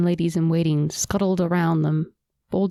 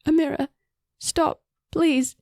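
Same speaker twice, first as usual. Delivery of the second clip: much higher, pleading and frantic; desperate plea; 9 semitones higher than usual. A narrator's reading. Sister begging Amira to stop beating queen, frantic pleading. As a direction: beg her to stop, near tears.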